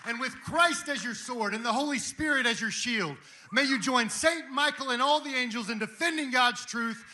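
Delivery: loud, high, shouted exhortation; full-force preaching. Real, not acted. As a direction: rouse the crowd at the top of your voice. Preaches at full force to cheering crowd.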